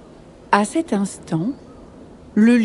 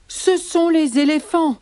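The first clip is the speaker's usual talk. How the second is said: high-pitched zebra voice; 7 semitones higher than usual. Acted. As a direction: pass the blame in a high voice. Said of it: "disent les zèbres".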